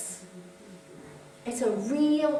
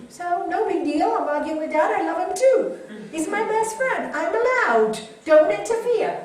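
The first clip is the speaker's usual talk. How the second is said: playful mock telling-off; 6 semitones higher, 10 dB louder than usual. Mom playfully telling kids off.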